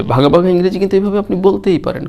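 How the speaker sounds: usual speaking voice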